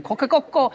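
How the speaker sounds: high mock crow, Coquecoco; Japanese rooster crow. Showing the Japanese rooster call, high Coquecoco. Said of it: "Los japoneses dicen coquecoco".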